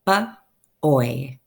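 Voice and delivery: ba-oy, the sound stretched apart; segmented word. Shown as a demonstration. Teacher sounding out "Ba-oy" for young readers. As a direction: split "Ba-oy" slowly into its sounds. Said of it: "listen for the sound at the end".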